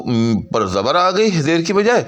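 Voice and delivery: ordinary delivery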